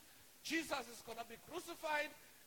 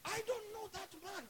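high-pitched emphatic denial; acted protest of innocence; indignant denial; 8 semitones higher than usual. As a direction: disown him in a raised pitch. Acts Peter denying Jesus.

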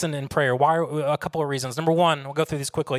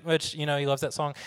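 ordinary delivery

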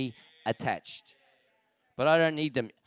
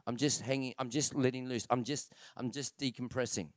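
mock excuse-maker; 3 semitones lower, 7 dB quieter than usual. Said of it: "someone saying".

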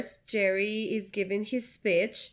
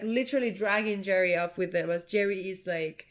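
usual speaking voice

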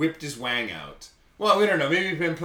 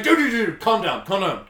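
higher, louder, urgent pleading; urgent calming; 8 semitones higher, 6 dB louder than usual. Retold or imitated. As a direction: grab him and talk him down fast. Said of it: "He's like".

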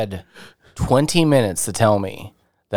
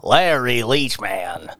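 character voice; 3 dB quieter, much slower than usual. Pretend. Introduces odd character voice.

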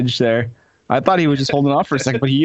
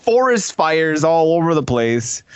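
ordinary delivery

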